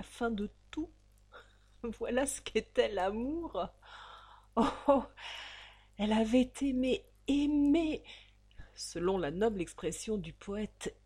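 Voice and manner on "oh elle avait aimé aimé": louder, higher, swept up in passion; passionate outburst. A narrator's reading. Narrator crying out the heroine's lost love. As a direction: let the love burst out.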